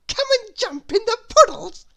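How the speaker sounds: high, loud, eager call to play; excited child cry. A narrator's reading. Little Miss Naughty calling Miss Wise to the puddles, high and gleeful. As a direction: shout it like a gleeful little girl. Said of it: "cried Little Miss Naughty".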